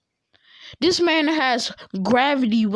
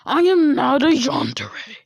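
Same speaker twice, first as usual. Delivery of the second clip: mildly raised mock protest; indignant denial; 3 semitones higher than usual. Teased girl denying the yandere act, mock indignant. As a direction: protest the label with play-acted outrage.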